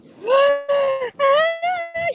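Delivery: high loud gasp at icy water; gasp-shriek from the cold. Real, not acted. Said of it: "I'm gonna probably scream really loud".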